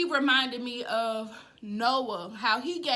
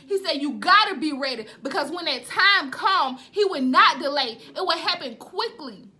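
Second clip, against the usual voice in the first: raised, insistent preaching cadence; fervent preaching; 3 semitones higher, 5 dB louder than usual. Real, not acted. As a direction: press the urgency on the listeners. Preaches urgent repeated warning.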